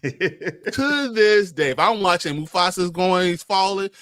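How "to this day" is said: very slow, high, drawn-out words; heartfelt but half-laughing insistence; emphatic confession. Real, not acted. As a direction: stretch each word out with a laugh. Emphatic confession about Lion King.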